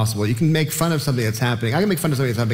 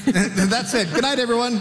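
laughing; 11 semitones higher, slower than usual. Real, not acted. Joking sign-off after a dark line.

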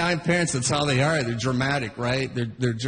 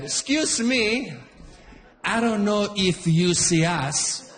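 slow, mock-polite Italian accent; a hint of an overly polite Italian father; Italian dad accent; slower than usual. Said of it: "My Italian dad".

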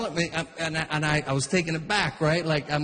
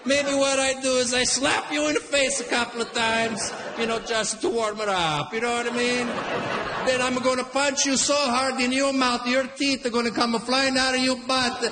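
dad threatening the rude guy with comic menace; Italian dad threatening; 4 semitones higher than usual. Retold or imitated. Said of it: "My Italian dad right away".

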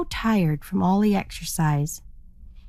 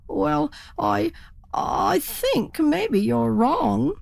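hesitant turkey; 7 semitones higher, much slower than usual. A narrator's reading. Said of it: "said the turkey".

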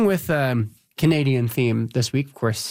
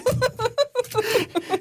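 high laugh. Real, not acted. Laughing along with co-host.

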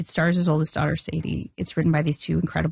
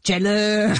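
put-on goofy comedian voice, drawn out; Adam Sandler impression. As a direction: imitate the famous comedian calling out. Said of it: "It's the real Adam Sandler voice?".

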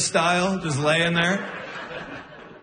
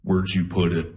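low, creepy sleep-talker voice; Buffalo Bill; 9 semitones lower than usual. Retold or imitated. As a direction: drop it low like a movie killer. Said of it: "Why do you sound like Buffalo Bill?".

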